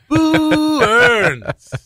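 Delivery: high, long drawn-out cartoon boo; mock crowd jeer. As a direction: stretch it out in a high put-on voice. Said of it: "they're saying boo earns".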